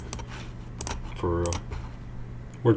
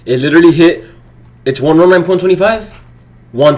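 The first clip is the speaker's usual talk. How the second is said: indignant; 6 semitones higher, 8 dB louder, faster than usual. Real, not acted. Price reached target.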